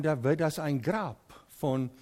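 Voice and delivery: ordinary talk, nothing marked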